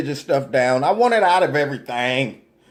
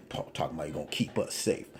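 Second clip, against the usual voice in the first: under-the-breath sarcastic mutter; muttered aside; 13 semitones lower, 14 dB quieter, faster than usual. Real, not acted. Sarcastic commentator muttering about Trump's promise. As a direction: mutter it almost to yourself, fast.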